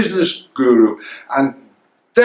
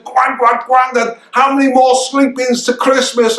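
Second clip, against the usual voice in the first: excited grandson; 7 semitones higher than usual. Retold or imitated. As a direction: mimic a kid who can't wait for Christmas. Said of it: "He says".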